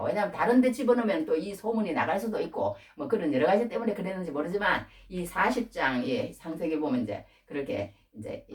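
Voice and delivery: ordinary talk, nothing marked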